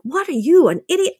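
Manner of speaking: scolding herself in a high, mocking pitch; self-scolding. Berating herself in the car, high mock scolding. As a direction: sneer at yourself, pitched high. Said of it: "practically cursing myself".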